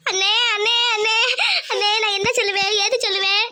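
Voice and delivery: theatrical tearful wailing to brother; put-on crying. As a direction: wail as a staged performance. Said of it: "எதுக்கு நீ அழுதுட்டு இருக்க". (நடிப்பாக அழுது புலம்பல்)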